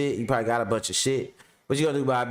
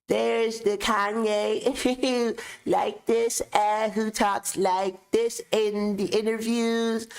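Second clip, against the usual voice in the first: interview [name] impression; 9 semitones higher, slower than usual. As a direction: do [name]'s polite interview voice, high and drawn out. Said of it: "I got two [name] impressions".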